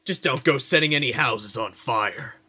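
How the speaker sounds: slow, stern town guard. Retold or imitated. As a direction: warn like a bored city guard. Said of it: "one of them said to me once".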